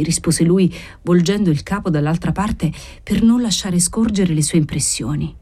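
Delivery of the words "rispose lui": usual speaking voice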